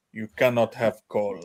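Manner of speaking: put-on half-hearted German dad accent, strict. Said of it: "You sound like a Russian".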